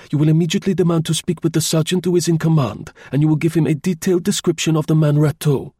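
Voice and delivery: high, insistent voice of command; curt, authoritative. A narrator's reading. Chauvelin orders Tournefort to ride to the gate, curt and authoritative. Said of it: "usual curt, authoritative manner".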